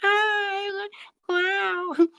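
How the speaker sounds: very high puppet voice. Acted. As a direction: squeal it as a smitten puppet. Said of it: "you change the puppet".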